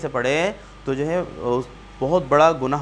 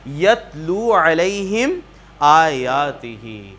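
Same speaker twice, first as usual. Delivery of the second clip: slow chanted recitation; slow tilawat of the verse; Quranic recitation; 3 semitones lower, much slower than usual. Acted.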